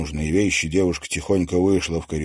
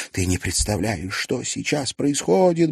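usual speaking voice

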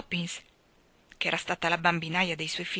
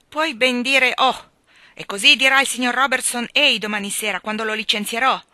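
furious; 5 semitones higher, 10 dB louder than usual. A narrator's reading. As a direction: lay down the threat. Husband furiously threatens firing. (minaccioso, forte e lento)

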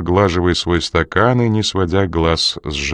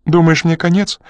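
higher frightened question; fearful question; 9 semitones higher, 3 dB louder than usual. Acted. Crew asking Coridon if he is doomed, scared. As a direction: ask it like a scared man.

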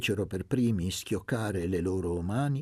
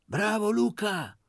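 high, loud cheer; the village women cheer Luca, high and loud; 13 semitones higher, 5 dB louder than usual. A narrator's reading. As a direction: cheer him on from the crowd.

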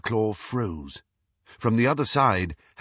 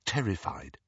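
ordinary delivery, nothing marked

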